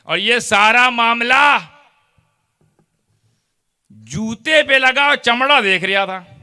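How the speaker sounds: loud, high, pleading complaint of the shoe leather; impassioned preaching. Acted. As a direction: cry out the injustice at full force. Preacher voicing the leather, rising to full force. (जूते के चमड़े की ऊँची, जोशीली फ़रियाद)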